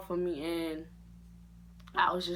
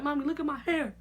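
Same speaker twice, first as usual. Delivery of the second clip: upset whining to mommy; 9 semitones higher, 4 dB louder, faster than usual. Retold or imitated. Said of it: "I'm crying, I'm all upset".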